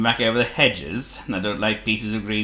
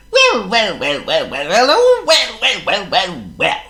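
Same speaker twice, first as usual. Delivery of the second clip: high, louder bird voice; a chirpy, fussy bird; high loud blackbird voice; Charles the Blackbird; 12 semitones higher, 6 dB louder, slower than usual. A narrator's reading.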